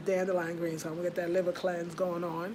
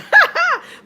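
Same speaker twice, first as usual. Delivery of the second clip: loud burst of laughter; 16 dB louder than usual. Real, not acted. Laughs at own mispronunciation.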